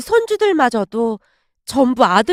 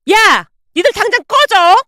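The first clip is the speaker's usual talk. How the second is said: loud, high-pitched outburst; angry shout; 6 semitones higher, 6 dB louder than usual. Acted. Mother throwing son's family out, shouting angrily. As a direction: yell them out furiously.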